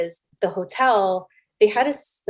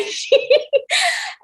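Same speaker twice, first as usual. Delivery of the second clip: high, squealing laugh; real laugh. Real, not acted. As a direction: burst out laughing at your own story. Laughs at her own story.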